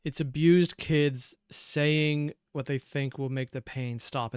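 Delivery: ordinary talk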